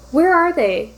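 angry growl. A narrator's reading. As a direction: snarl it out loud, hunting them down. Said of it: "Steve growled".

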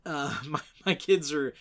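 ordinary talk, nothing marked

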